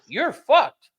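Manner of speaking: loud, high-pitched mocking jeer; retold taunt. Retold taunt at Canadian golfer.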